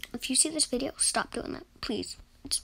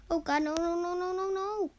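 panicked; 6 semitones higher, faster than usual. Real, not acted. Monster attack in horror game.

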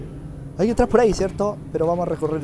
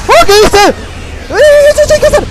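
much higher, loud startled yell; startled shout; 12 dB louder than usual. Real, not acted. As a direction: caught off guard, shout it. Surprised by enemy.

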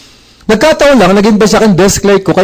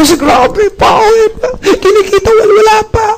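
much higher, giddy squeaky voice; gleeful reply. Retold or imitated. Recruiter happily admitting he earns nothing yet, giddy. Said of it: "tuwang-tuwa sumagot ako".

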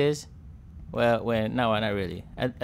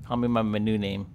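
ordinary talk, nothing marked

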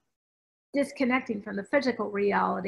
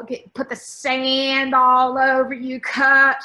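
loud command; 2 semitones higher, 10 dB louder, much slower than usual. Retold or imitated. To her reluctant client on the beach, a loud bossy order. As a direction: bark it at her like a drill sergeant.

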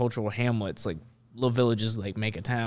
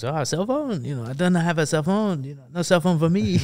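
high-pitched, hammed-up French accent; 7 semitones higher, 6 dB louder than usual. French reporter denying he has a cell phone, in a hammed-up French accent. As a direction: play an evasive French reporter. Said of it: "I can do a French accent".

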